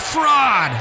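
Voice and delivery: high, falling shouted echo. Acted. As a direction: throw it back like an echo. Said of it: "and echo answered".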